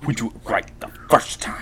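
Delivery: breathy whispered mock accent line; hushed, badly faked Schwarzenegger accent. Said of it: "That was a horrible accent".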